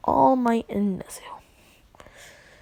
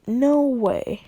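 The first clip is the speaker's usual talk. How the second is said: louder, higher indignant refusal; firm refusal; 4 semitones higher, 7 dB louder than usual. Acted. They turn down his money demand, loud refusal. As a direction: refuse it outright, voice lifted and loud.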